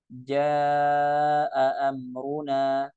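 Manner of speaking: "jaa amruna" drawn out very slowly, both hamzas sounded; both hamzas clear. Shown as a demonstration. Teacher reciting for students, very slow and clear. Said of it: "Jelas dua-duanya".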